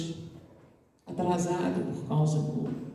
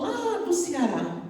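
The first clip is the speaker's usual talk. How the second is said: higher, slow and longing; wistful answer; 8 semitones higher, slower than usual. Retold or imitated. The homesick student tells the girl where he comes from, high and wistful. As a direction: sigh out the far-off home.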